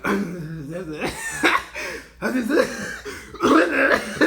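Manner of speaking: loud mock coughs breaking into laughter; fake coughing. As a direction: put on a cough, then crack up. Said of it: "I was, like, coughing and pretend".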